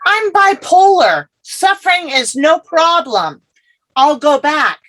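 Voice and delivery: high, bright and eager protest; eager insistence. Retold or imitated. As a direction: brush off the warning eagerly. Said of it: "I said".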